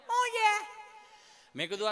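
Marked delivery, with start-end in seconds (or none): 0.1-0.8 put-on much higher child voice; cheeky child. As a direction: snap back like a cheeky kid. (ઊંચો, ઉદ્ધત બાળકનો અવાજ)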